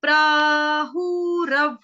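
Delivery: प्राहुरव drawn out very slowly and loudly; slow broken-up demo. Shown as a demonstration. Teacher breaking the word apart for learners, very slowly. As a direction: chant प्राहुरव slowly with a falling end. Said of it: "तोड़ेंगे कैसे?".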